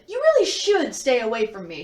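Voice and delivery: ordinary talk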